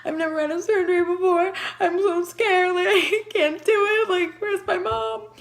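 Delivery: high, loud, panicked plea; frightened patient begging for her mom; scared pleading. Retold or imitated. [name] telling the doctors she's scared, high panicked pleading. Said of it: "I was like".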